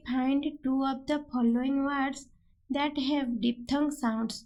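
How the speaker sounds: very slow, word by word; slow question read. Shown as a demonstration. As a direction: dictate the exam question slowly. Teacher reads exam question aloud.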